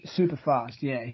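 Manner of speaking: ordinary talk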